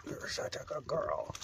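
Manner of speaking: whispered praise. Real, not acted. Praising the dog softly.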